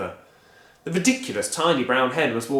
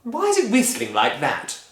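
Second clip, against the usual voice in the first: a touch higher, uneasy question; a hint of alarm; mildly worried wife; 8 semitones higher, 3 dB louder than usual. Missus Cranky uneasy about the hen, lightly worried.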